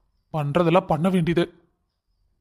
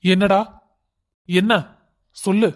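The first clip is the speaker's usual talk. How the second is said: louder, curt answer to his son; irritated snap; 3 dB louder than usual. Acted. Angry father snapping at his son to speak up. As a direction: cut him off, impatient.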